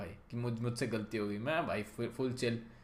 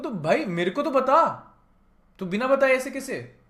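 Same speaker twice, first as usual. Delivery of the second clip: raised, higher voice, slow indignant complaint; upset demand; 11 semitones higher, 9 dB louder than usual. Retold or imitated. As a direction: demand an explanation, loudly hurt. Confronting girlfriend about cut call. (ऊँची, नाराज़ आवाज़ में शिकायत)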